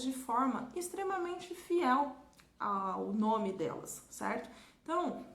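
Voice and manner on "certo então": ordinary talk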